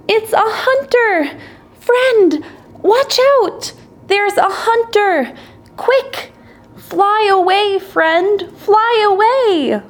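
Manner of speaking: high urgent warning shouts; tiny ant crying out. A narrator's reading. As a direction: cry out in alarm in a tiny voice. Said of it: "Even after the ant's cries".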